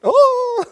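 a high, loud mock "Oh!"; sharp exclaim. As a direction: burst out a loud high cry.

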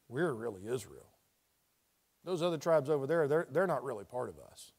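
low, soft, dismissive remark; imagined private aside. Acted. Israelites talking among themselves about the other tribes, low and quiet. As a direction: drop your voice as if confiding among insiders.

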